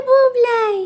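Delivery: high, loud sing-song pet name; calling the pet. Real, not acted. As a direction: coo the nickname out loud.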